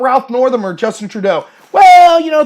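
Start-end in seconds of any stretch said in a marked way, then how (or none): 1.7-2.4 loud, high, stretched-out "well"; mock hedging. Partisans asked about their own politicians, mock evasive. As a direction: imitate an evasive excuse, blurting it high. Mimics excuse for Northam, Trudeau.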